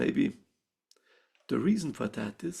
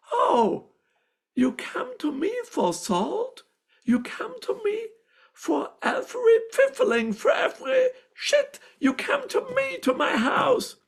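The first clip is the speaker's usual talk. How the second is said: much higher, louder furious shrieking; the neighbor's wife scolds her for begging salt, shouting; angry neighbor; 8 dB louder than usual. Retold or imitated. Neighbor's wife berates her over salt, high furious shout. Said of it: "she got really angry with me".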